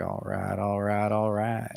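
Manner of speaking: a hint of laid-back Texan catchphrase; Texas accent. Host teasing what listeners expect, light Texas accent. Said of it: "expects me to sound like".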